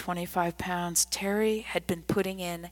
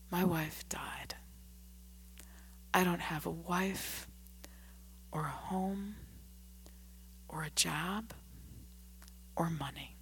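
whispered, much softer confession; despairing; 13 dB quieter than usual. A narrator's reading. Grieving drunk man tells the old man, whispered despair. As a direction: let the words fall away, broken.

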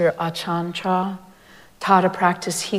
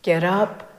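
usual speaking voice

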